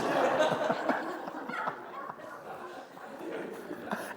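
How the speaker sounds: long high burst of laughter; helpless high-pitched laugh. Real, not acted. Preacher to congregation, real high laughter.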